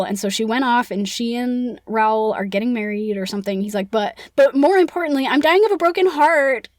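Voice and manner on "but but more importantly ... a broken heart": higher, louder mock lament; so dramatic. Eric begging the Persian's sympathy, so dramatic. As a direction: fish for pity, hammed up. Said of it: "being, like, so dramatic".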